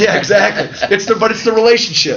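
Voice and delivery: laughing through the words, agreeing; light chuckle while agreeing. Real, not acted.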